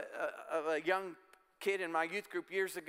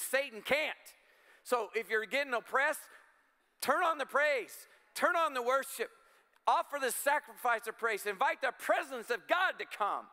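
preaching at full force; 6 semitones higher than usual. Acted. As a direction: lift the congregation into praise. Preaching climax on praise.

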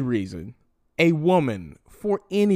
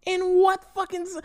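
much higher, strained; outraged disbelief. Real, not acted. Still reeling at the apology demand, high strained outrage. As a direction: push the pitch up in shock.

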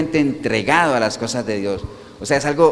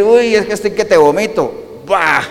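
acted disgust, higher-pitched, ending in a retch; nauseated; 4 semitones higher, slower than usual. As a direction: act about to throw up. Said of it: "le genere ese sentimiento al Señor". (con náuseas fingidas)

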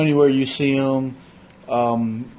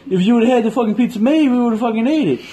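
louder, higher mock outrage; indignant retort; 8 semitones higher, 3 dB louder than usual. Retelling his comeback to the clerk, mock outrage. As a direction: tell the clerk off, half laughing.

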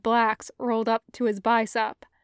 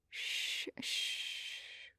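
soft, voiceless shushing; Cody soothing the starving general, hushing him in a whisper; 18 dB quieter than usual. Acted. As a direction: hush him down gently, breath only.

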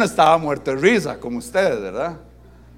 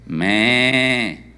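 imitated long sheep's baa; sheep bleat. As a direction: bleat like a sheep in one drawn-out note. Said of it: "¿qué se escuchaba?". (balido de oveja imitado)

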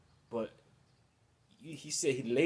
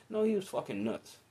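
ordinary talk

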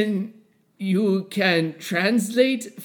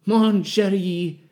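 slow, drawn-out gibberish French; fake French accent; slower than usual. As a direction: purr nonsense French slowly. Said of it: "fun little accent".

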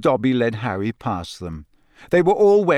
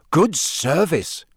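slow, raised, impressed drawl; 5 semitones higher, slower than usual. A narrator's reading. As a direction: savour it, openly impressed. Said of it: "Ron said in an impressed voice".

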